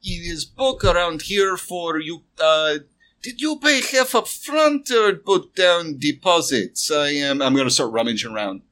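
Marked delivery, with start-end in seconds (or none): 0.0-6.9 higher, slow shopkeeper voice. Acted. Shopkeeper asking the customer about payment, halting and higher. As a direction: ask haltingly in a put-on shopkeeper's voice.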